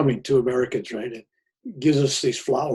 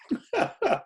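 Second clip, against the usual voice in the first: high burst of laughter. Real, not acted. Laugh after retort.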